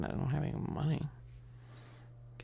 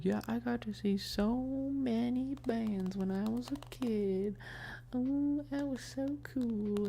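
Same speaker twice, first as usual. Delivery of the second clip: high mocking mom voice; high, gushing impression of mom; imitating mom; 11 semitones higher, slower than usual. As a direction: mimic mom bragging in a high voice. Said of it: "my mom's over there being like".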